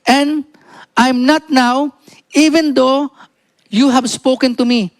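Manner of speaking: high, slow, deliberate Bible reading; scripture reading. Acted. Reads scripture aloud to congregation. (mataas at mabagal na pagbasa ng Bibliya)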